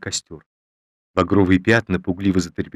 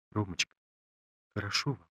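quiet trembling voice; 12 dB quieter than usual. A narrator's reading. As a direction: ask softly, voice trembling. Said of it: "спросила тихим, вздрагивающим голосом".

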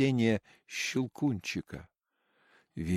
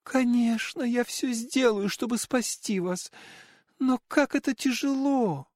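put-on little girl voice; 11 semitones higher than usual. A narrator's reading. Marie pleads with Nutcracker.

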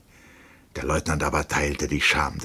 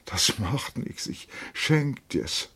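slow, high, softened voice; tender drunken offer; 7 semitones higher, 4 dB quieter, slower than usual. A narrator's reading. Lieutenant consoles the captain, slow, soft and high. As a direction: drag it out softly and high.

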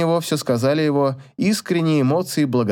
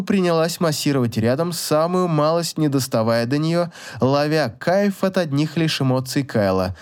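usual speaking voice

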